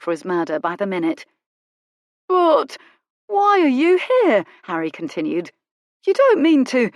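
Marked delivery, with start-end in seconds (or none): 2.3-4.4 high, loud, slow baffled servant voice; puzzled footman. Acted. Footman questioning Colin, high and puzzled. 6.0-6.9 high, loud, hesitant servant voice; confused footman. A narrator's reading. Footman fearing a robbery, high and confused. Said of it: "His forehead bunched in confusion".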